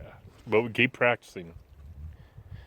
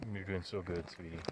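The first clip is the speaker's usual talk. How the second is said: tender encouragement; 4 semitones lower, 12 dB quieter than usual. Real, not acted. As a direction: praise her softly and tenderly. Dad softly encouraging daughter.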